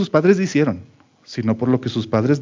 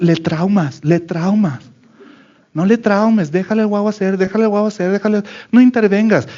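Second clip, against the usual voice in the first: mock advice; 6 semitones higher than usual. Mocking hands-off parenting advice.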